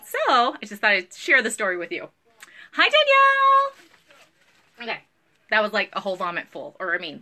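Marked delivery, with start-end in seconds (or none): 2.7-3.7 very high, loud sing-song hello; excited greeting. Real, not acted. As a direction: squeal hello to a friend. Greeting a viewer.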